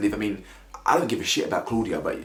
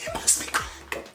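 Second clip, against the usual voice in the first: squeaky whisper; 4 dB quieter than usual. Shown as a demonstration.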